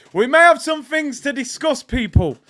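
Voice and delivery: high, shouted warning; loud announcement. Real, not acted. Streamer telling people about Monday's show, high and shouted. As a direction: belt it out to the whole audience.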